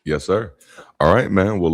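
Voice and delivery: ordinary talk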